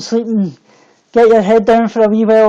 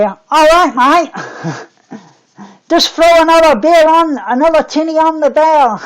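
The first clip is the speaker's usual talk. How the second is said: higher-pitched, hammed-up Australian; Australian accent; 5 semitones higher, slower than usual. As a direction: put on a jokey Aussie accent. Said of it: "speaking with Australian accents".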